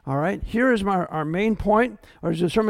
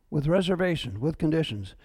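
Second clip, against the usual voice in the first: normal talking voice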